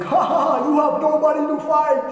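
loud, high, hammed-up gloating laugh; mocking giant. Goliath jeers at Israel with no challenger, mocking. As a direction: crow over them with a big fake laugh.

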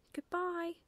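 high, drawn-out goodbye; owlet's farewell. A narrator's reading. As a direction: chirp farewell as a little owl. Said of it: "said Plop".

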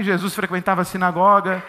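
ordinary talk

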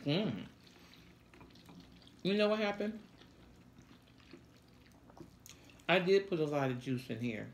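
talking while chewing; muffled words between bites; mouth full of noodles. Real, not acted. Seen 1: Woman in maroon chews noodles with full cheeks while talking.

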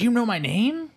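put-on high-pitched little boy question; mock-astonished child voice; amazed kid. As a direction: imitate a kid in wonder. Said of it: "And Mikey's like".

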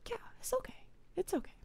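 soft, whispered reassurance; calm a nervous child; hushed and tender; soothing whisper. Retold or imitated. Soothing the worried kid.

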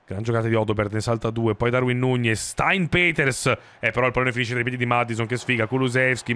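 normal talking voice

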